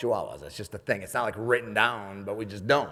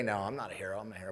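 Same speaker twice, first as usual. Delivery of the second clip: mock modest reply; 3 semitones lower, 9 dB quieter, faster than usual. Shown as a demonstration. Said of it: "you're supposed to say".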